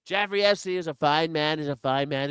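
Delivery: ordinary talk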